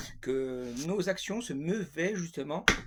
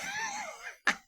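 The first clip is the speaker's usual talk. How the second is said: burst of laughter. Real, not acted. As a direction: crack up, high and helpless. Cracks up mid-explanation. (éclat de rire aigu)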